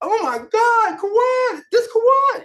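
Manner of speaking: high, loud delighted cry of recognition; excited reunion squeal. Retold or imitated. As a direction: squeal the name like a long-lost friend calling. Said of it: "How does it make you feel?".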